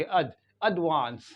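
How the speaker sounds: quick "ud", then एडवांस as "ud-VAANS"; short schwa in अड, long "aa" in एडवांस; short first syllable. Shown as a demonstration. Teacher showing the quick first syllable, British pronunciation. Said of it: "जल्दी पढ़ेंगे".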